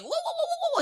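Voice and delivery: very high monkey whooping; monkey hoots. Acted. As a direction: hoot like an agitated monkey. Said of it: "And the monkey's like".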